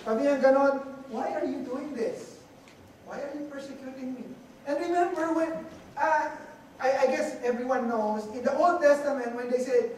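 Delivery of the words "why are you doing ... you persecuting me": softly spoken plea; Lord's soft question. Acted. Preacher voices the Lord questioning Paul, softly. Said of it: "the same question he was asking Paul".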